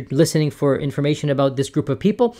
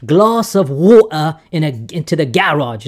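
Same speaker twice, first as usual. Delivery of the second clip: fake British accent; 3 dB louder than usual. Shown as a demonstration. Showing how Brits pronounce words differently, fake British accent. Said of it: "my fake British accent here".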